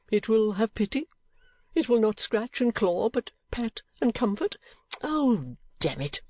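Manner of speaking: high, theatrical self-pity; wheedling foppish husband. Acted. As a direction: plead pitifully in baby talk to an angry wife. By this tender appeal.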